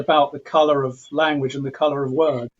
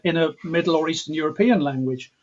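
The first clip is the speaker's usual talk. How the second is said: ordinary talk, nothing marked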